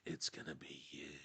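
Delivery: whispering. Pretend. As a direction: drop to a secret hush. Repeated phrase, hushed take.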